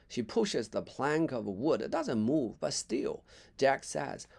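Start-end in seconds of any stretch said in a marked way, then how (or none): none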